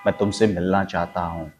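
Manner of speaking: low, soft, insistent; quiet insistent desire; urgent low stress. Shown as a demonstration. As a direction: press मैं and चाहता with low urgency. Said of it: "मैं ही चाहता हूं और अभी का अभी".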